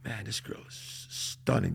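soft, slow, hushed awe; awed murmur. Retold or imitated. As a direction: breathe it out in wonder. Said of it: "and I said".

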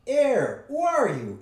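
high, loud squeak of a talking purse. A narrator's reading. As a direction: squeak out a sharp challenge. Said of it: "it squeaked".

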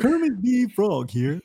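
hammed-up Kermit through giggles; Kermit the Frog. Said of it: "The best I can do".

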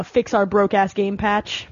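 normal talking voice

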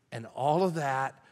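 usual speaking voice

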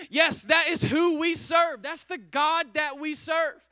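loud, slow, emphatic praise; booming declaration of faith; slow full-force preaching. Real, not acted. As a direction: land each word like a proclamation.

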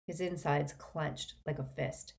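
usual speaking voice

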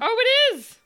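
bright surprised exclamation; sudden delighted surprise. Real, not acted. Surprised at phone playing.

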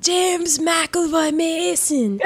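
slow, drawn-out actor impression with an Irish touch; James Mason. Riffing on co-host's Irish remark, mashed-up James Mason impression. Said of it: "So some James McAvoy, James Mason".

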